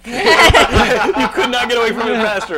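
slow, loud and high, laughing as he teases. Real, not acted. Host mocking a guest for dodging the song, laughing. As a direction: tease while cracking up.

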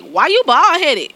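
put-on small child asking an adult; kid's question. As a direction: ask it like a blunt little kid. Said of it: "the one little one was like".

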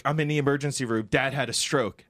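usual speaking voice

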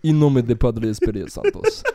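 a hint of a mangled Spanish prayer; mock Spanish prayer. Mocking how the class mumbled the prayer, lightly accented. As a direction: recite it like a sloppy classroom prayer. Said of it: "when the prayer is like".

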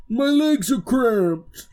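loud, slow ogre wail; complaining ogre. Acted. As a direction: drag out a high-pitched ogre moan. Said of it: "as this ogre is not enjoying his current situation".

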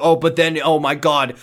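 usual speaking voice

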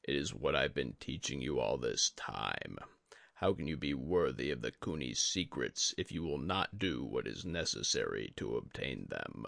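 a dying sensei, calm and heavy; Yori the old master. A narrator's reading. Yori reminds Tadaka.

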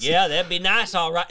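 a hint of a Southern good ol' boy; Southern accent. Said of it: "It's Southern again".

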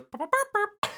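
very high squeaky burps; mock jingle sound; imitating fanfare. Echoing the fanfare joke in a high voice. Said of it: "He goes".

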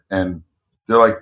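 ordinary delivery

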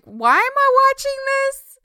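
very high-pitched mock wail of disbelief; exasperated self-questioning, way up high; mock exasperation. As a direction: squeak it out in hammed-up dismay. Acting out own disbelief at film.

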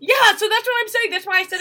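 high, loud, insistent agreement; excited vindication. Real, not acted. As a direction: pounce on the point in triumph. Vindicated in playful argument.

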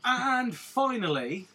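slow, high, stretched-out "And finally"; drawn-out announcement. Pretend. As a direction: stretch "And finally" like a grand reveal. Mock grand drawn-out announcement.

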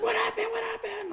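put-on high kid voice, worried; little brother's voice. Ten-year-old brother asking his sibling, high worried voice. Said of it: "my brother's, like, ten".

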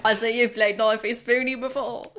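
playful put-on Aussie accent; mock Australian accent. Co-host capping the knife joke, mock Australian accent. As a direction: answer in a silly Australian accent.

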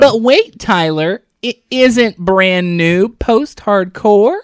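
loud, sharp interruption. Real, not acted. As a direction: cut in hard and loud. Cutting in on the argument.

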